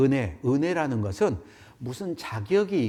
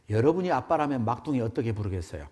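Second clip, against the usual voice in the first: ordinary talk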